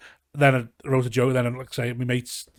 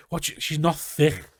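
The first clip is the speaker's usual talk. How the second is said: louder, higher indignant telling-off; retold exasperated rant; 4 semitones higher, 6 dB louder than usual. Telling off his jobless cousin about the cash, exasperated. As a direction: scold him like he's daft.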